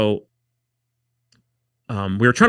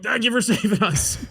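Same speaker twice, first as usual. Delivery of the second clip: mock sheepish thanks; 11 semitones higher than usual. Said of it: "I'm like".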